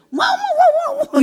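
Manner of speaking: high strangled yelping woof; muffled dog yelp. Retold or imitated. Deaconess imitating her dog-bark tongues, high yelps. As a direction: yelp like a dog held back. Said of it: "세게도 못하고 작게도 못하고".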